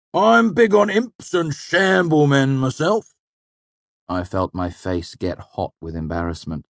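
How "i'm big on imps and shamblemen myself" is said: slow, loud, high mock pomposity; absurdly puffed-up. A narrator's reading. Manet mocks the boy's interest, absurdly pompous. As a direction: puff yourself up and declaim it. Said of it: "He puffed himself up absurdly".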